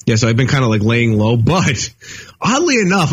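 ordinary talk

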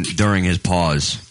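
ordinary talk

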